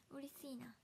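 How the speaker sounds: soft whispered murmur; whispered to self. Real, not acted. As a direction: breathe it to yourself. 独り言のささやき. (小さくささやく)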